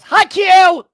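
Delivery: sudden piercing yell of a greeting; shouting as loud as he can. Acted. Startling the busy cook with a greeting shout. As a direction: blast the greeting at full volume. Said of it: "as loud as he go-, he goes".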